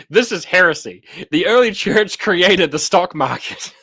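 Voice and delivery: loud, high mock outrage, slowing down; outraged commenter. Reading out a viewer's comment on socialism, mock outraged. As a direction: declaim it as a hammed-up accusation. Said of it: "Someone just commented".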